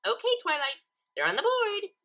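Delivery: high, bouncy cheer; cheerful. A narrator's reading. Pinkie cheerfully telling Twilight the numbers are up. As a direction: sing it out happily. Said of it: "in her cheerful tone".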